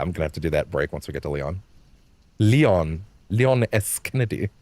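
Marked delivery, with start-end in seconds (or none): none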